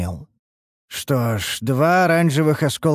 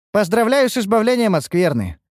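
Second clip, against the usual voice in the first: much higher-pitched congratulation; congratulating elf woman; 11 semitones higher, 3 dB louder than usual. A narrator's reading. Ниуру to the cleansed youth, congratulating. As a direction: congratulate in a high feminine character voice.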